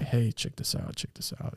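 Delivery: imitating a kid's furtive whisper; hushed, voiceless playful whisper; whispered aside. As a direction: whisper it to the friend beside you. Imitates youths whispering to each other.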